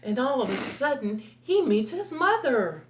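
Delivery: plain reading voice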